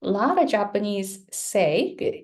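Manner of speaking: normal talking voice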